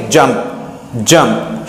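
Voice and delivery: जंप with a brief uh, low; short vowel sound. Shown as a demonstration. Teacher gives the right reading, short-vowel जंप. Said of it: "short sound है".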